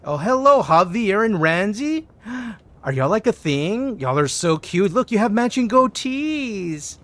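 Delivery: high, delighted cooing at a cute pair; excited fawning, words stretched; gushing. Pretend. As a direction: greet them with teasing, sing-out delight. Gushing over two customers as couple.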